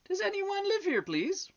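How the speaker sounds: very high, gentle enquiry; gentle butterfly. A narrator's reading. Butterfly knocking a second time, gently insistent. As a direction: ask politely in a high little voice. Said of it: "a little louder, but still very gently".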